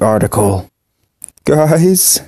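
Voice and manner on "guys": calling out higher, voice shaking; trembling call to the others; shaky voice. Acted. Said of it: "in a shaky voice".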